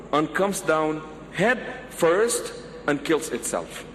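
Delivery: ordinary talk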